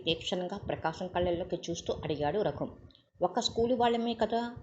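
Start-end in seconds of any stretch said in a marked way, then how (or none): none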